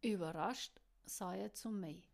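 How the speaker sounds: ordinary delivery, nothing marked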